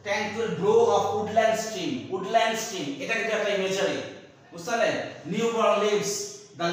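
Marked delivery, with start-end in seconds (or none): 0.0-2.9 slow poem recitation. Shown as a demonstration. Teacher reciting an imagery line to the class, slow and stretched. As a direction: read the image slowly for students. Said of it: "দেখো".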